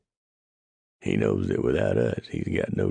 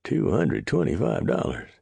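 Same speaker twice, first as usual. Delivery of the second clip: high astonished gasp; old trapper gasping at the sum; gasping disbelief; 14 semitones higher than usual. Acted. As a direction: nearly choke on the number. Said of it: "Clyde gasped".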